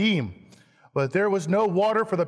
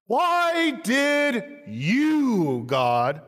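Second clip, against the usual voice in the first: slow, higher accusing whine; accusing complaint; 4 semitones higher, slower than usual. Acted. Preacher voicing Israel's complaint against God, slow and accusing. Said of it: "this is their complaint".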